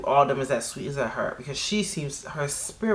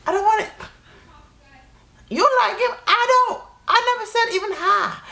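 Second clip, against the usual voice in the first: high squealing protest; 3 dB louder than usual. Acted. Mock argument with jealous girl.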